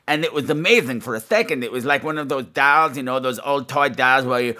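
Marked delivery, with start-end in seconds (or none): none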